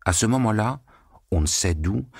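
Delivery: usual speaking voice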